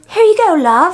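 high-pitched mock British accent. Imitating a friend at the restaurant, put-on British accent. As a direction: slip into a chirpy put-on British lilt. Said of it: "slips into a British accent".

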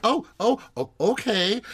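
high, flustered oh oh okay; startled. Retold or imitated. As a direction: caught off guard, voice jumping up. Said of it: "Dorit's like".

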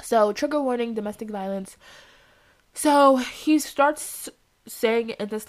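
normal talking voice

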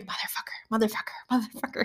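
imitated anonymous whisper; hushed, voiceless repeated cussing. Unknown student whispering for six days, whisper. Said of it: "six days of somebody whispering".

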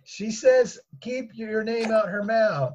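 high, slow calling voice. Acted. Daughter passing mother's answer to husband, calling out. Daughter relaying messages across the house.

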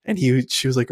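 ordinary talk

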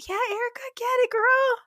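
put-on shrieking hype-girl cheer; hyped cheering. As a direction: egg her on like a cheering bestie. Cheering on a friend.

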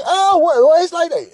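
high, mock-indignant retort; offended woman. Date reacting to being thrown out, mock offended. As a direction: imitate her affronted comeback up high.

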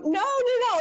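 quick high-pitched protest; high reassuring no no no. Real, not acted. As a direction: brush it off, up high. Reassuring while tearing up.

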